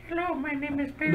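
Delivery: high, eerie squeaky greeting; creepy little mouth voice. Acted. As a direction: speak as a tiny mouth in the flesh.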